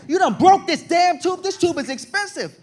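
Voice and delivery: high, loud furious nurse voice; irate nurse. Retold or imitated. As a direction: shriek at him like an irate nurse. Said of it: "she's, like, irate".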